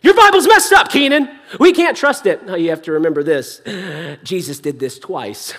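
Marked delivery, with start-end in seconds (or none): 0.0-2.5 higher, louder objector's outcry; mock protest. Skeptic objects to the preacher about his Bible, mock protest. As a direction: blurt it out as a scandalised objection.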